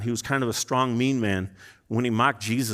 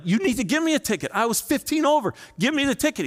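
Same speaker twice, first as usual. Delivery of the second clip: pitched way up, begging for the ticket; pleading insistence; 10 semitones higher than usual. Retold or imitated. Preacher to the cop, insisting on getting a ticket, high pleading. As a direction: push hard, almost whining for the ticket.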